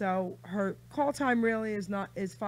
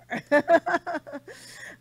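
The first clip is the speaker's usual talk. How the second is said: burst of laughter; 8 semitones higher, 9 dB louder than usual. Real, not acted. Host delighted by guest's accent.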